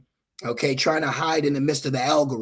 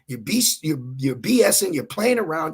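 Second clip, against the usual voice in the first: ordinary talk, nothing marked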